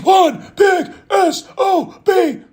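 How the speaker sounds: slow, high, loud shouted insult; a real hyped-up yell at the big man. Real, not acted. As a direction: bellow it out with relish.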